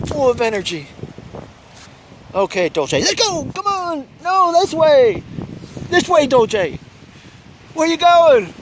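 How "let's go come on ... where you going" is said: high, loud calling after the dog; sharp playground warning. Real, not acted. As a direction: call the dog back sharply.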